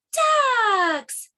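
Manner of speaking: DUCKS high, loud and drawn out, falling; excited exclamation. Acted. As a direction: cry "Ducks!" as a long delighted discovery. The ducks are finally found.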